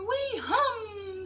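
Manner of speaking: high buzzy little bee voice. Acted. Baby bees answer the queen bee, high. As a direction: hum it out like tiny honeybees.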